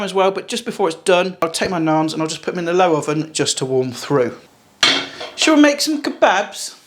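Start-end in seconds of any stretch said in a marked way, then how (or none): 5.3-6.7 slow, high, sing-song invitation; playful question. Acted. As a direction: tease it out slowly.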